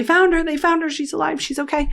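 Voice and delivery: high, excited cry of good news; retold scream. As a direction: shout the news, thrilled. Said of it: "He's screaming".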